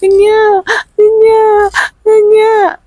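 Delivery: put-on high wailing baby cry; mimicking a baby's first cry; imitated newborn cry. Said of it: "I just heard".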